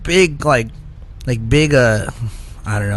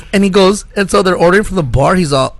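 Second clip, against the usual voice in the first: usual speaking voice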